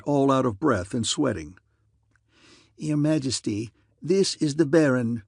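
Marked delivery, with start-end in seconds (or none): 2.8-5.2 lightly voiced official making an introduction; court official. A narrator's reading. Official presenting the Baron to the Queen, formal. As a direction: announce the guest formally to royalty.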